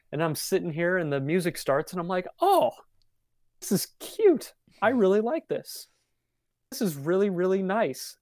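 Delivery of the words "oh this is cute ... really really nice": high, gushing delight; lightly sweet adoring voice; mock gushing. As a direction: coo over how cute it is. Gushing over the sweet song.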